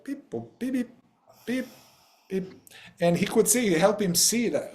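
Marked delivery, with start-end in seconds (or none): 0.0-2.4 high, softer robot bleeps; small high-pitched beep-boops, spaced out. Retold or imitated. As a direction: imitate a droid stuck in beeps. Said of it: "now he was like".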